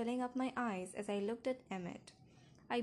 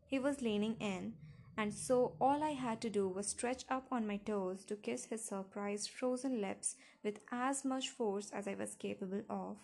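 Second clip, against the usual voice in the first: ordinary delivery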